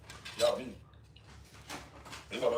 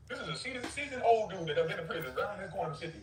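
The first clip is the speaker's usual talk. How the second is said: ordinary delivery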